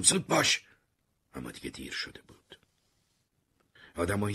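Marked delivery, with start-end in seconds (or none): 0.0-0.6 high, loud urgent yell; shouting. Acted. Marty yelling at Terry to hurry. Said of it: "داد زدم".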